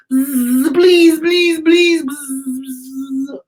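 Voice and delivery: high buzzy bee pleading; buzzing bee. Shown as a demonstration. Host buzzing like a bee for the kids. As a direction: buzz and beg like a little bee. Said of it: "Can you buzz like a bee".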